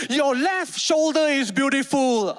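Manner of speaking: high, slow instructor call; shouted affirmation. Retold or imitated. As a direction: call out absurd praise with full conviction. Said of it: "My teacher could shout anything".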